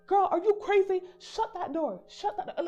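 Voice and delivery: high, loud angry yelling; cussing out. Retold or imitated. Driver and conductor yelling at her to shut the door, angrily. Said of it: "they're cussing me out".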